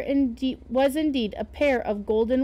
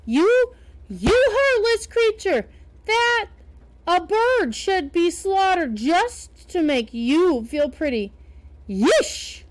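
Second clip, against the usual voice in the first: high, loud indignant scolding; indignant outburst; 6 semitones higher, 4 dB louder than usual. Acted. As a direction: let her have it, shrill with outrage. Minna scolds the fashionable lady.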